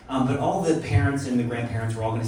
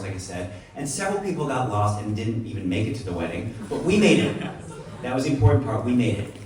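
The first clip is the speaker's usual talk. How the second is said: normal talking voice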